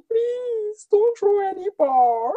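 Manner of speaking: high-pitched hammed-up wail; drawn-out tearful begging, played for laughs; mock crying plea. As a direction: imitate a sobbing plea. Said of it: "once Teresa started crying".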